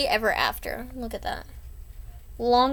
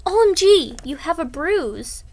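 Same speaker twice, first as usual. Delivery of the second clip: shocked gasp; 7 semitones higher, 6 dB louder than usual. Acted. Girl gasps at bruise.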